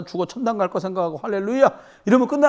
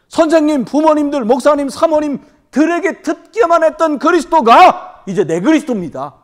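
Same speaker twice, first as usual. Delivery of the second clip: rising exhortation; 7 semitones higher, 8 dB louder than usual. Real, not acted. Preacher's climactic appeal.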